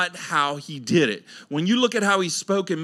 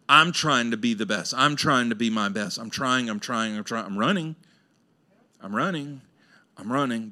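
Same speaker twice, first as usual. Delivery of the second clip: weary and worn out; 6 semitones lower, 5 dB quieter than usual. Retold or imitated. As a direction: lower and softer, fading tired repeats. Exhausted striving chant.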